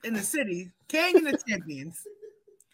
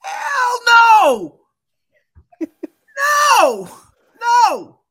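loud laughing refusal; shouting no; 11 semitones higher, 10 dB louder, much slower than usual. Real, not acted. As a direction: yell it out, high and loud. Refusing to back Giants.

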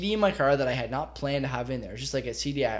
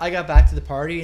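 ordinary talk, nothing marked

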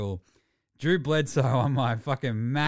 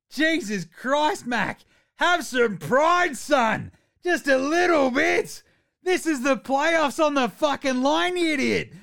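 furious rant; 10 semitones higher, 4 dB louder, slower than usual. Real, not acted. Furious fan ranting at quarterback.

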